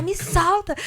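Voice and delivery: high-pitched re-enacted cry of protest; retold yelling. Wife held down by husband, retold high-pitched yell. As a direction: imitate yelling to be let go, pitched well up. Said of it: "E eu comecei a gritar".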